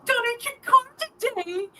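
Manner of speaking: high, put-on cheesy commercial voice; mock ad voice. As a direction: parody a goofy TV ad kid. Mocking a kids charity ad.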